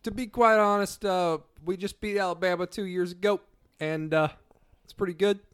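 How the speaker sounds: high, loud hammed-up coach answer; mock coach interview. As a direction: play a coach dodging the question. Mock post-game press answer.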